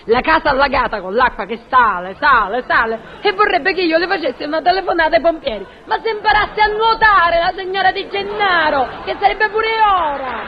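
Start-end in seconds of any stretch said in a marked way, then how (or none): 3.2-10.4 high, exasperated complaint; indignant rant. Acted. Portiera ranting about a flooded neighbour, high and indignant. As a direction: climb high in scornful indignation.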